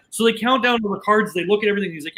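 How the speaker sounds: ordinary talk, nothing marked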